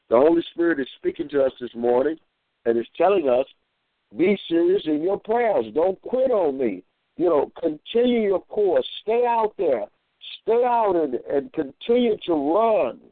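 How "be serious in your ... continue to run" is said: higher, rising preacher's exhortation; preaching. Acted. Preacher urging the congregation to keep running, higher. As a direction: urge the listeners on like a pulpit call.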